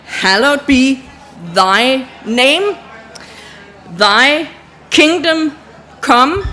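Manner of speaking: slow, solemn prayer with long pauses; slow prayer recital. Shown as a demonstration. Teacher leading the audience in signed prayer, slow and solemn. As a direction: pray it word by word as you sign. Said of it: "full prayer recital with signs".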